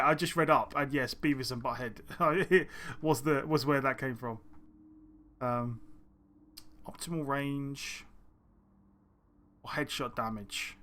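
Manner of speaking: normal talking voice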